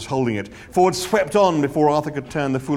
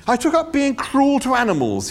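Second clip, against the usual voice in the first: airy; 12 semitones higher, 5 dB louder than usual. A narrator's reading. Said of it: "he said airily".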